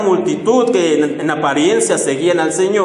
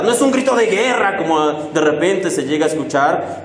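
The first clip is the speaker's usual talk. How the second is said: usual speaking voice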